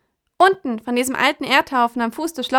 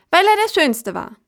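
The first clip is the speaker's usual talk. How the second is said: arglos stolze Krähe; 7 semitones higher, 4 dB louder than usual. Acted.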